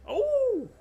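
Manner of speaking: sudden high startled cry; a sharp gasp of shock; surprised. Real, not acted. Reacting to replay of crash.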